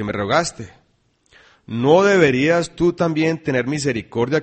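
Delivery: loud, ringing Bible reading to the church; scripture reading. Acted. Preacher reading Mateo aloud, loud and ringing. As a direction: proclaim the verses to the congregation.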